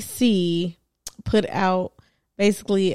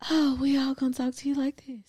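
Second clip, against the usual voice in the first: higher, soft whispery brothers' voice; mock whisper talk; 5 semitones higher, 7 dB quieter than usual. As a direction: tease them with a breathy little whisper. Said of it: "They all whisper talk".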